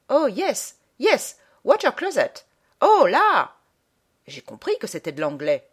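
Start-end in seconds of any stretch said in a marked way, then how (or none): 0.1-3.6 put-on high-pitched caricature Englishman, English accent. Imitating an Englishman squirming on the train, English accent. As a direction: exclaim it in a mock English accent, growing louder. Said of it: "il en avait l'accent quand il parlait".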